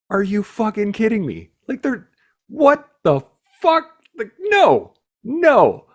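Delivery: half-laughing rage at the sergeant major's thread; retold fury. Recruit telling how furious he was at the inspection, near-shouted disbelief. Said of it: "and I was furious".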